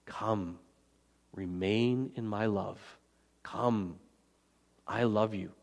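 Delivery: soft, slow, coaxing welcome; offering the words as an open invitation, not an order; tender invitation. Shown as a demonstration. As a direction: invite them gently, don't command. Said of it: "as it is an invitation".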